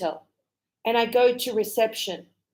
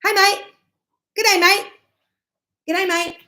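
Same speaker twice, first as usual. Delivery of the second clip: high, loud, cheery "Good day, mate" like a builder; Aussie worker greeting; 10 semitones higher, 5 dB louder, faster than usual. Retold or imitated. Teacher imitates construction workers greeting each other, loud Aussie. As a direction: call it out like a tradie on site. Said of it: "they're very".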